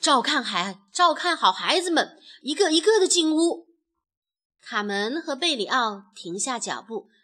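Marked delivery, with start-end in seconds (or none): 0.0-3.7 put-on loud, urgent herding of children; shouted orders. A narrator's reading. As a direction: take charge and call out instructions. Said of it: "卡梅拉大喊道". (大声指挥大家)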